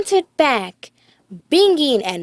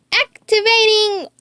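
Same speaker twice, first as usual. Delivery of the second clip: Riley voice; 8 semitones higher than usual. A narrator's reading. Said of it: "said Riley".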